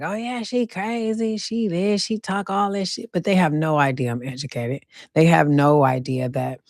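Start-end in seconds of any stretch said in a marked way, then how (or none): 0.0-3.1 gossiping critics. Retold or imitated. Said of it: "they just probably hear me talking like".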